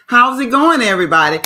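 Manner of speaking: big loud hello to the viewers; loud, upbeat stream greeting. Real, not acted. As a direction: call out a cheerful welcome.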